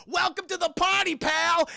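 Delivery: high, loud taunting yell; screaming out the window. Retold or imitated. Hero yells at the cop from the window, loud taunt. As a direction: shout it out the window with cocky glee. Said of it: "he's just screaming out the window".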